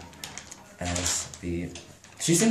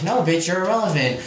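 arguing; 7 semitones higher than usual. Retold or imitated. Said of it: "they started arguing".

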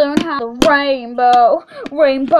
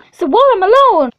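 indignant shout; 7 semitones higher, 8 dB louder, faster than usual. Acted. Voicing game character, indignant.

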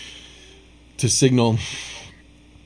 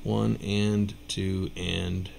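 slow counting; 3 semitones lower, 7 dB quieter, much slower than usual. Shown as a demonstration. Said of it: "Apparently I need to practice".

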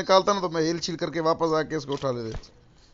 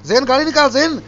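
panicked shouting; 5 semitones higher than usual. Real, not acted. Panicked orders to drive away.

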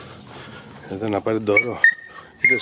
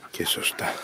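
whispered aside; 8 dB quieter, slower than usual. Real, not acted. Commenting over hunting footage.

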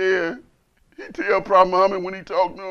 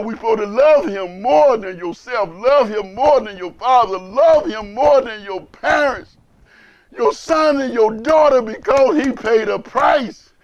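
louder, slow, voice full of feeling; emotional preaching; 3 semitones higher, 6 dB louder, slower than usual. Real, not acted. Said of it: "Excuse me for being emotional".

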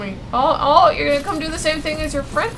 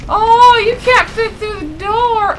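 taunting; 7 semitones higher, slower than usual. Real, not acted. Taunting monster stuck in door.

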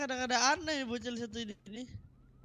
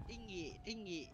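mock kid voice; 15 dB quieter than usual. Imitating kid saying tall.

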